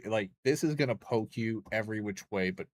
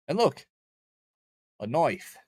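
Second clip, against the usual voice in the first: excited Australian "knife"; mock Aussie accent; 4 semitones higher, 4 dB louder than usual. Streamer pointing out a game character's knife, mock Aussie accent. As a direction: put on a playful Aussie accent, pitched up at first. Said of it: "Hey, look, an Aussie".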